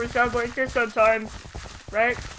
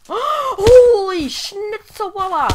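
slow, loud gasp of amazement; surprised exclamation; 6 semitones higher, 9 dB louder, much slower than usual. Real, not acted. Gamer stunned by a play, slow and loud. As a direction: blurt it out astonished.

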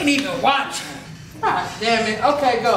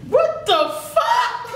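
shocked; 14 semitones higher, slower than usual. Real, not acted. Blindfold touch game, shocked laugh.

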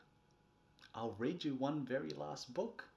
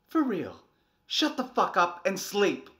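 loud, higher angry shout; exasperated yell; 7 semitones higher, 11 dB louder than usual. Acted. Fed-up parent yelling at the child to sleep. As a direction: blow up at the kid.

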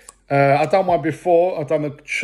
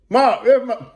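calling out; 7 semitones higher, 4 dB louder than usual. Real, not acted. Calling to someone off camera.

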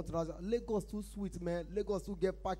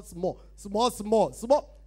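growing steadily louder and higher; volume turning up; 3 semitones higher, 7 dB louder, much slower than usual. Acted. Priest turning the comedian's voice back up, growing louder. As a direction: build like a radio knob being turned up. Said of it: "the man turn out rich".

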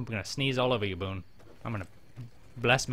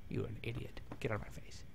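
muttered mock insult; 11 dB quieter than usual.

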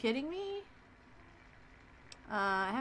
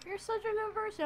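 mock sweet coo; 13 semitones higher than usual. Player coos at the game shopkeeper.

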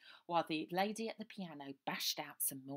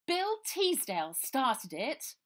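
high, very slow, loud posh English protest; posh West End accent; 10 semitones higher, 12 dB louder, slower than usual. Acted. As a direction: put on a plummy West End accent, indignant. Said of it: "in a posh voice like you heard up west".

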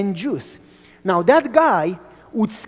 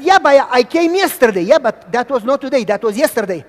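protesting; 4 semitones higher, 8 dB louder than usual. Retold or imitated. Quarrel with vendor.

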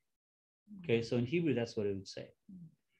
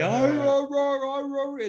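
much higher, squeaky pet voice; be a dog chirping its love; cute dog voice; 13 semitones higher, 5 dB louder, slower than usual. Retold or imitated. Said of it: "you hear dogs say".

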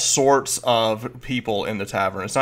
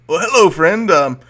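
booming, higher jovial greeting; Volo greeting; 5 semitones higher, 7 dB louder than usual. Acted. Game master as Volo greets the party, loud and jovial. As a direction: hail the newcomer like a showman. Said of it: "he looks over and goes".

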